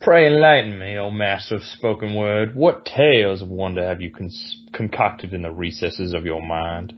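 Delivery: lightly put-on Southern plantation accent; Southern genteel accent. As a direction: savour every word in a Southern genteel accent. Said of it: "What'd you think of my accent?".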